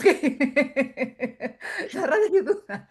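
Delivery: slow, laughing through the words; giggling repeat. Retold or imitated. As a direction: repeat her line while cracking up. Quote repeated while laughing.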